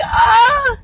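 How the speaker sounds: loud high-pitched "oh" cry; high gushing moan; overwhelmed wail.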